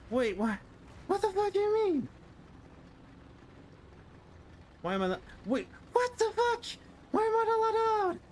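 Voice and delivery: real furious high-pitched shouting; outraged shriek. Real, not acted. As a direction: scream in disbelief at the game. Blocked by map, outraged.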